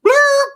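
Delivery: short high-pitched police siren whoop; siren blip. Retold or imitated. Police siren behind Rolls Royce.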